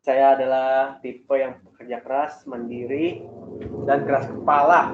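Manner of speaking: slow, deliberate formal self-presentation, firmly projected; mock-formal interview answer, measured and emphatic; job-interview self-introduction. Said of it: "Ini kita bukan, bukan mau interview".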